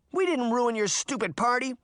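high, indignant retort; defensive child's protest; arguing. A narrator's reading. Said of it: "Sabrina argued".

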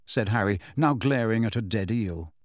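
ordinary delivery, nothing marked

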